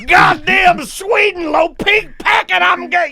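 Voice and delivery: high, slow mock-furious shouting; angry dad. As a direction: imitate a dad screaming over a sweetener. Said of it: "very angry that my mom had".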